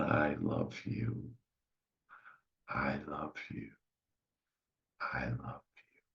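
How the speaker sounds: very soft, dwindling to a whisper; tender hushed reply. Acted. Listener answering their inner child's love, tender fading hush. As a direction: answer intimately, each repeat fainter.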